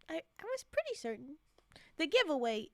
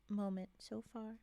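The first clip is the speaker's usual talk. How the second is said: normal talking voice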